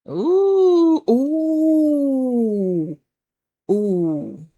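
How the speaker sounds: high, drawn-out ooh falling away; excited ooh. Real, not acted. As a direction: coo over a great card. Reacting to cards.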